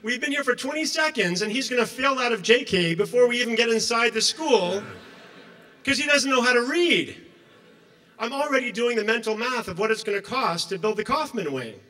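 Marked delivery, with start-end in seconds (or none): 0.0-9.9 mounting panic. Real, not acted. Panic about son failing JK.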